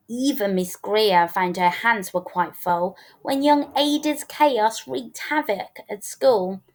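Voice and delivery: ordinary delivery, nothing marked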